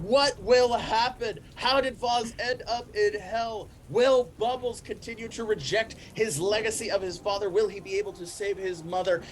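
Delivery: game master's melodramatic to-be-continued voice; next-time teaser. A narrator's reading. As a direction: ham up the cliffhanger questions like a TV trailer. Next-episode teaser narration.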